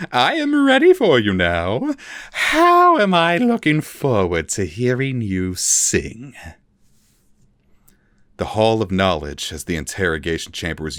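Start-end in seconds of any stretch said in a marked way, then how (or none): 0.1-6.3 slow, menacing and higher; Vilnix voice. A narrator's reading. Vilnix tells his prisoner he is ready, slow menace. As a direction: savour each cruel word.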